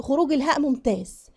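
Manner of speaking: ordinary talk, nothing marked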